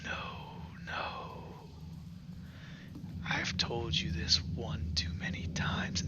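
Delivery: whispered rasp from the dark; raspy whisper. Acted. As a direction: hiss it like a sly hobgoblin. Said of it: "you hear a raspy, familiar voice".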